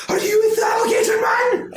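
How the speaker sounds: high shrill accusing shout; high hen voice demanding answers; suspicious chicken lady. Acted. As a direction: shriek the question at them.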